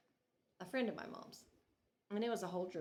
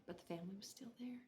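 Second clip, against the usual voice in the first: whisper; 12 dB quieter, faster than usual. Retold or imitated. Realizing mourners nearby.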